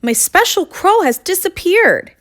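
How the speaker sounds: high-pitched rooster character voice; distressed rooster. Acted. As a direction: lament in a high voice. Rooster upset at losing his crow.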